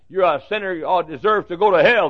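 usual speaking voice

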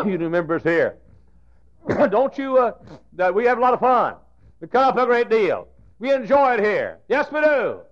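high, loud preacher's exhortation; preaching at full force. Acted. Preacher assuring visitors they have fun, loud and high.

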